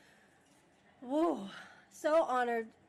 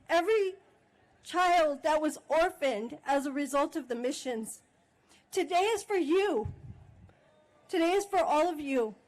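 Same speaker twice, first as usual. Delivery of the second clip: higher and louder, rising to a fervent call; impassioned speech; 4 semitones higher, 4 dB louder than usual. Real, not acted. Chairwoman honouring the enslaved ancestors, fervent and loud. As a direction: speak for the ancestors with fire, building.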